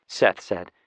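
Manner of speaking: plain reading voice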